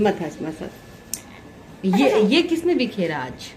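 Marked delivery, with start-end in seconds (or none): none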